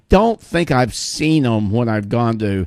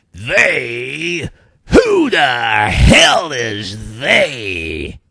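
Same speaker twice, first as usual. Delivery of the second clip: put-on cantankerous old guy, very slow and loud on the second question; Edmund O'Brien impression; 3 dB louder, much slower than usual. In a restaurant, mimicking the old actor for friends, slow loud indignant. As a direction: bellow it like a crotchety old man who won't take "they" for an answer. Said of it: "I go into my Edmund O'Brien mode".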